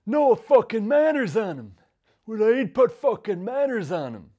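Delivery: high, loud and slow, a different voice; furious dad ranting. Retold or imitated. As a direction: rant it slowly like a furious father. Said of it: "he'd say".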